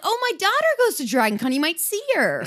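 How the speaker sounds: chirpy imitation of an oblivious parent; mimicking a nosy acquaintance. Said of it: "they're like".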